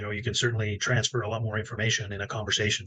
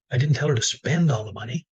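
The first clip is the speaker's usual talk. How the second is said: "spend" stressed, louder and rising; 3 semitones higher, 5 dB louder than usual. Shown as a demonstration. The next person on stage stressing "spend". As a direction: punch "spend". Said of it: "emphasize a different word".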